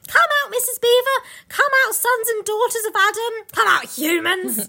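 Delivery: acted jubilant shout; Mister Beaver shouting. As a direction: summon everyone from hiding, stretching the last call. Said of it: "he shouted".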